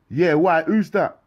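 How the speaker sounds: high, loud reply; shouting. Acted. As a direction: shout back across. Calling out window.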